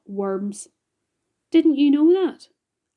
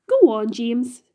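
ordinary delivery

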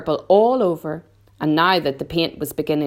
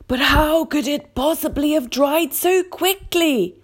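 put-on puzzled old grasshopper; 11 semitones higher than usual. A narrator's reading. Old Green Grasshopper asks about the quickly dried paint, puzzled. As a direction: ask in astonishment as an old grasshopper.

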